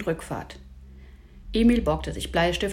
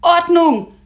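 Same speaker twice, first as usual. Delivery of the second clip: high, very loud call to order; commanding cry over a squabble; shouted command; 11 semitones higher, 12 dB louder than usual. A narrator's reading. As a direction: shout the group back into line. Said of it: "rief der Professor".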